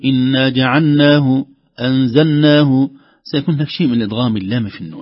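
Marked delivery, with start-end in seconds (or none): 0.0-2.9 slow, lam clearly separated from the nun in جعلناه; careful recitation. Shown as a demonstration. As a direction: keep the lam distinct in أنزلناه. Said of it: "لو لم ننتبه ستضغم، نقول".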